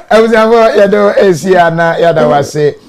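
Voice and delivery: loud high announcer thanks; booming announcement. Real, not acted. Host loudly thanking listeners like an announcer. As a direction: boom the thanks out to the family.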